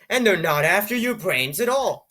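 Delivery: ordinary talk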